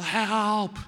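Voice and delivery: loud, high, drawn-out cry for help; yelled plea. Retold or imitated. Sponsor's morning prayer advice, yelled plea. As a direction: yell it out of a window. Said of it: "open the window, and yell".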